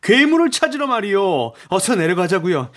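high, impatient insistence; exasperated urgency. A narrator's reading. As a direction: press them hard to get moving. Raoul presses the Persian to hurry.

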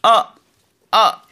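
"아" falling sharply from high, punched; Mandarin fourth tone. Shown as a demonstration. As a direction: chop "아" down fast like a karate strike. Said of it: "사성은 힘을 내서".

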